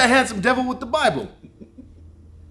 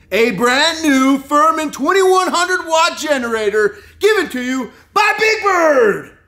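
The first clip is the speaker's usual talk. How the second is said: loud, slow, higher announcer boom; announcer shout; 7 semitones higher, 7 dB louder, slower than usual. Acted. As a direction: boom it like a game show host. Giveaway announcement hype.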